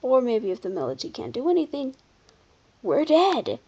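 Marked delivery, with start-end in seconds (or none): none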